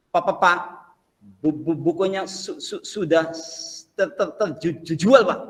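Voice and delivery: stammering, syllables repeated haltingly; stuttering job seeker. Acted. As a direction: stutter through every word like a nervous applicant. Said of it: "tahu ya orang gagap ya?".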